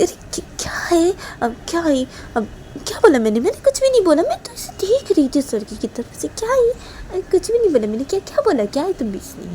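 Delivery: high, exasperated whine of denial; fussy, put-upon girl; protesting friend. A narrator's reading. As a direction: deny it and brush off the accusation. Voices protesting Neha.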